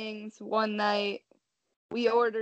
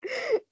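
high giggle. Real, not acted. Laughing at own phrase.

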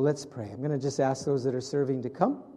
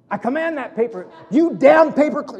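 higher, louder hammed-up shout at a paperclip; mock angry command; 9 semitones higher, 9 dB louder than usual. Preacher imitating a man yelling at a paperclip, hammed-up angry shout. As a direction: bark a comic order at the paperclip. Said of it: "he got really mad".

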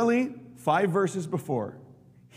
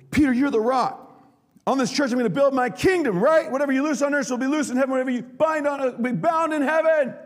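exalted proclamation; 12 semitones higher, 6 dB louder, faster than usual. Retold or imitated. Jesus voiced exalting Peter.

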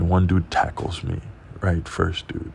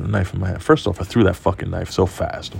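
normal talking voice

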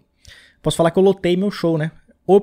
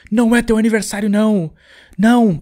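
angry yell; 6 semitones higher, 3 dB louder than usual. Pretend. Haters yelling on Instagram.

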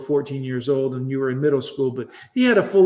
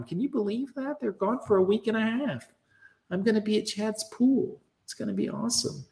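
high, hushed schoolgirl gush; mock girlish thrill, soft and high; excited girl; 4 semitones higher, 6 dB quieter than usual. Acting the excited girl to friend.

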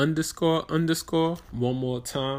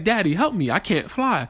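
put-on child voice; 7 semitones higher than usual. Daughter quoted again, pleading.

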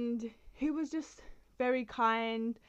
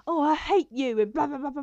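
mock hateful quote; 6 semitones higher, 9 dB louder than usual. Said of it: "you're like".

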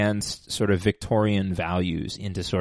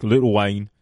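bad, hammed-up British accent; terrible mock-British accent; 4 dB louder than usual. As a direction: put on a silly British voice. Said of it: "like, a British person".

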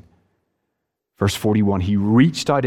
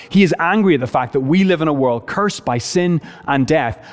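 normal talking voice

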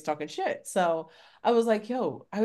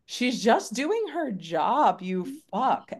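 slow, higher retold rebuke; indignant telling-off; 6 semitones higher, slower than usual. Customer defending a postal worker, indignant retold scolding. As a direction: stretch it out in exasperated defense.

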